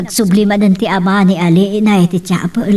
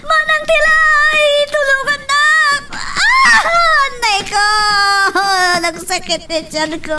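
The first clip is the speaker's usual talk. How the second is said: high, slow wailing cries with shouts of labor agony; crying out in pain; slower than usual. Acted. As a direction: scream and sob as the contractions hit. Labor pains peak, crying out. (agpukpukkaw ken agsangsangit iti ut-ot)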